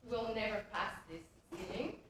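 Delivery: usual speaking voice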